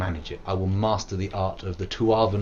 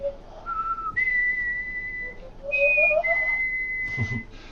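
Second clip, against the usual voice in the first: whistled tune; 4 dB quieter than usual. Pretend. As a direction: whistle the melody high and steady. Long high wordless whistle.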